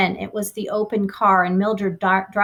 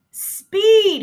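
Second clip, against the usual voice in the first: high, loud Speed barked over noise; shout; 12 semitones higher, 6 dB louder than usual. Acted. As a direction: yell Speed over the engine. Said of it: "he shouted".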